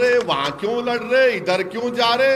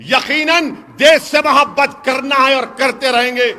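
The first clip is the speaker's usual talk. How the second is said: raised, driving orator's voice from a stage; fiery rally speech; 4 semitones higher, 7 dB louder than usual. Acted. Politician rousing a rally crowd, fiery full-force oratory. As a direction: hammer each line home to the crowd.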